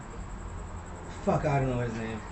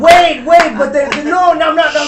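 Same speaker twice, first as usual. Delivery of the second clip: real loud, higher cry of wait to stop the others; urgent shout; 8 semitones higher, 10 dB louder than usual. Real, not acted. Shouting to stop his co-hosts misreading his comparison, loud. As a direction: shout them down to clarify. Cutting in to clarify.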